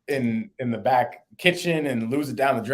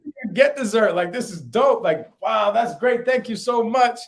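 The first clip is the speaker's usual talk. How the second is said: high, loud, hammed-up delight; mock gushing; 12 semitones higher, 6 dB louder than usual. Thanking the strangers for dessert, hammed-up high gushing. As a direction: squeal out the thanks like an overexcited diner. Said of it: "We're talking like that".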